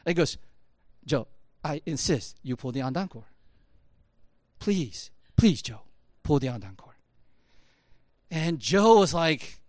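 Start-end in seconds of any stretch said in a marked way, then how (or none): 1.0-7.0 quiet insistence. Retold or imitated. Toyota quietly presses reluctant worker.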